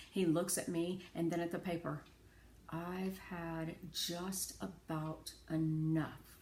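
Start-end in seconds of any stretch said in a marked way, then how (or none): 2.7-6.4 slow, softer, held-back warning; a father quietly at the end of his patience. A narrator's reading. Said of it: "he says quietly".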